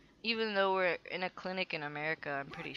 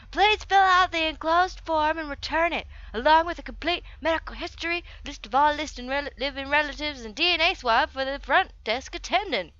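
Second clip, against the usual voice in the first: loud, higher promotional read; over-the-top brochure voice; 5 semitones higher, 7 dB louder than usual. Acted. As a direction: belt out the small print like an advert. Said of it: "should not do that with my voice too often".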